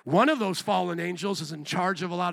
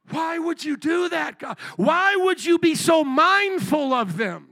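higher, slow, louder astonished cry; astonished angels; 9 semitones higher, 5 dB louder, slower than usual. Pretend. Angels questioning God, higher slow astonished plea. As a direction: plead like a bewildered angel. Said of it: "what the angels would say".

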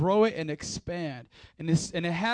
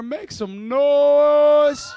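high shout with a very long-held last word; hyped crowd rallying; 6 semitones higher, 10 dB louder, much slower than usual. Real, not acted. Preacher calling one-on-one members to cheer, drawn-out shout. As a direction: stretch "noise" out like an arena announcer.

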